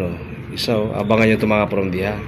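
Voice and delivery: normal talking voice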